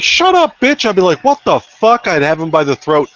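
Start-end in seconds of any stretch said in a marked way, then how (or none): 0.0-0.9 high-pitched imagined attacker; mock angry command. Acts imagined attacker's line. 1.2-2.1 indignant reaction. Pretend. Acts own imagined reaction.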